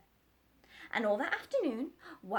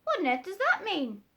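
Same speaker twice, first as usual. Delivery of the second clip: higher, louder puzzled question; surprised doctor, raised; astonished doctor; 9 semitones higher, 8 dB louder than usual. Acted. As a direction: ask in wonder.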